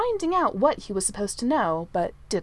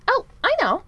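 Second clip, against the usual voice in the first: high, loud, jumping straight in; eager idea; 12 semitones higher, 7 dB louder than usual. A narrator's reading. Manservant volunteering a plan to the prince, eager. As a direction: pipe up with a sudden idea.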